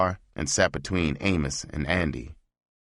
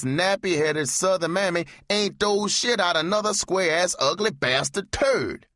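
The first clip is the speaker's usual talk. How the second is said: much higher, hammed-up minstrel caricature; over-the-top mock Kingfish routine; 14 semitones higher than usual. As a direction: lampoon the two men in a shrill put-on voice. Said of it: "They didn't dig my humor".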